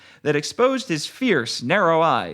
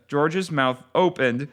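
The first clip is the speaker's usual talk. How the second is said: usual speaking voice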